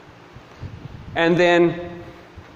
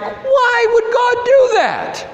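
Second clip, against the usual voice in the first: high-pitched mock outrage; hammed-up incredulous protest, words stretched out; David; 3 dB louder, slower than usual. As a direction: mimic a scandalized complainer, voice very high. Acts out David shocked at Uzzah's death.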